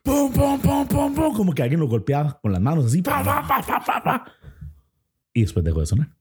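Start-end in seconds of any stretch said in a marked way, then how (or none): 0.0-1.3 high sharp pum pum knocks; banging noise. Retold or imitated. Imitating banging on door. 3.0-4.3 quick high pa pa slaps; slapping noise. Retold or imitated. Imitating hands slapping door.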